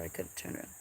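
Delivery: soft unvoiced aside; whisper. Real, not acted. Owner talking to a cat behind her, whisper. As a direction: breathe it as a hushed aside.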